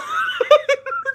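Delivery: high giggling burst; real laughter. Real, not acted. Quoting dad and cracking up.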